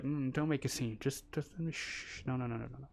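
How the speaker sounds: soft, hushed shushing; hushing. Retold or imitated. Imitating Europeans hushing someone who makes a scene. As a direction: hush someone to avoid a scene. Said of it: "Europeans are like".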